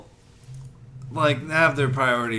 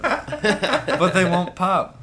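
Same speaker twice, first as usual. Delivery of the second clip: laughing; 8 semitones higher, 6 dB louder, much slower than usual. Real, not acted. Joking objection, laughing.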